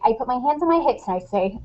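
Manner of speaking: ordinary talk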